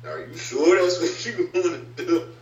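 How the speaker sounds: high, doubtful questioning voice; incredulous. Retold or imitated. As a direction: raise the pitch in disbelief. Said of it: "People look at you kinda like".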